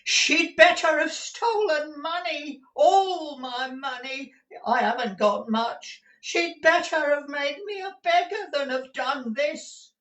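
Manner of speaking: put-on high-pitched wailing old miser; old man wailing over a disaster; anguished lament. Gride laments the theft.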